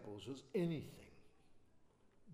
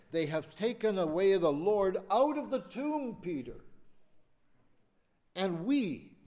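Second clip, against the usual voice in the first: raised, pleading voice of Mary; Mary's plea; 5 semitones higher, 7 dB louder than usual. Acted. Preacher voicing Mary telling Peter the tomb is empty, raised and pleading. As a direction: cry it out as a distraught Mary.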